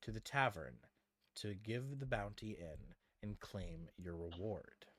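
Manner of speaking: scene narration. Acted. Game master bringing the party back to town, measured narration.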